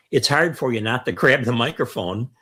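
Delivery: usual speaking voice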